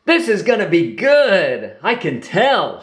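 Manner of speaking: bubbling with anticipation; excitedly. A narrator's reading. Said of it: "she said excitedly".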